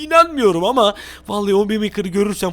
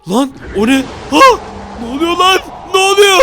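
high, loud startled cries; frightened, high-pitched yelling; panicked shouting; 7 semitones higher, 5 dB louder than usual. Acted. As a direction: shout in alarm at a sudden sighting. Sees something frightening, panics.

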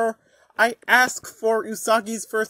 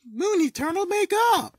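higher, louder transformation call; excited; 9 semitones higher, 3 dB louder than usual. Shown as a demonstration. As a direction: shout it like a magical girl. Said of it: "second take".

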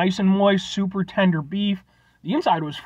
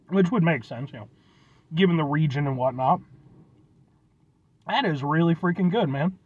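savoring food between words; chewing while talking; 3 semitones lower than usual. Real, not acted. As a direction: mouth partly full. Seen 0: Holds bitten empanada; lips pressed between phrases, chewing after earlier bite.

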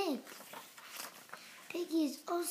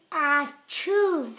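louder acted sneeze cutting the words; sneeze mid-sentence; 8 dB louder than usual. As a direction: sneeze right through the line. Sneeze breaks into speech.

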